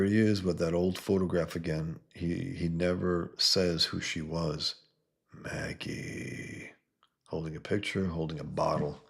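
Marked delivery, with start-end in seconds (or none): 5.3-6.7 breathy whispered Maggie; a sad whisper over a photo; whispered name. Acted. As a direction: barely breathe the name, grieving. Dad mourns over old photo.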